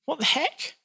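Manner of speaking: baffled reader's mock outburst; incredulous what the heck. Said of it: "we go".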